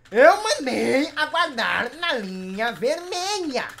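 slow, drawn-out reprimand; exasperated scolding. Real, not acted. Host reminding player of the red line order, exasperated. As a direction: stretch each word in exasperation. Repeating an ignored order angrily.

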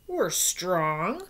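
a hint of proud crocodiles; crocodiles roar. Crocs answering the monkey, mild mock roar. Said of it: "roar the crocs".

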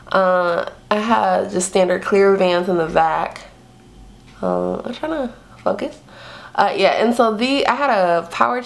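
talking while stretching mouth open; showing teeth with fingers; lips pulled apart by fingers. Real, not acted. Seen 0: fingers pull lip corners back to show braces throughout.

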